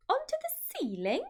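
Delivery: high, rising disbelief from a little monkey; a small child amazed; small monkey crying out. A narrator's reading. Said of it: "cried one of the small monkeys".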